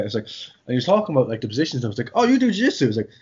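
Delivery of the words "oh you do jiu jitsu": surprised. Retold or imitated. Drunk at a festival, meeting a grappler, high surprise. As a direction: pitch jumps high in delighted surprise.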